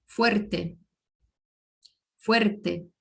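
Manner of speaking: fuerte with a short, lighter trill on the r; light rolled r. Shown as a demonstration. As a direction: say fuerte, r vibrating only a little. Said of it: "mas vibra um pouco menos".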